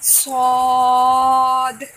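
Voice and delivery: सौद held for three alifs, slow and loud; सौद sung out very long and loud; elongated letter. Shown as a demonstration. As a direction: stretch सौद out long like a Tajweed drill. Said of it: "put your finger on first word".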